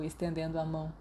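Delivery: usual speaking voice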